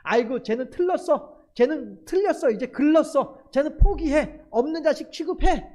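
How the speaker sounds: put-on a fed-up parent declaring the child hopeless; parent giving up. Preacher voicing a parent who gives up on a child, exasperated and loud. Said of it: "라고 하면서도".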